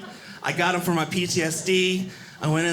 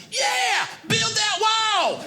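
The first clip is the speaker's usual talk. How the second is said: higher, slow hammed-up yell; 12 semitones higher, much slower than usual. Comic's mock yell of how people hear him. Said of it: "What they hear is".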